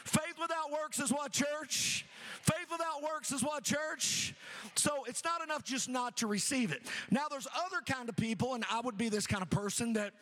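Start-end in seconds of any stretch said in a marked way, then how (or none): none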